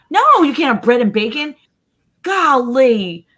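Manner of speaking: loud, high exasperated refusal, hammed up; mock exasperation. Mom fed up with her picky kid's order, mock exasperated. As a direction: throw up a mock-outraged protest.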